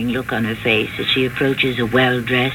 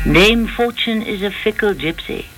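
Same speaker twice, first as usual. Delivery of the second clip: lilting, higher-pitched verse reading; theatrical host reciting verse; poem recitation; 4 semitones higher than usual. Acted. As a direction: recite the rhyme with measured pauses between phrases. Recites a poem before commenting.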